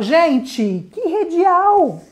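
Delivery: ordinary talk, nothing marked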